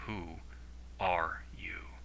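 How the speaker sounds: quiet, measured demand, word by word. A narrator's reading. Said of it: "Oliver demanded".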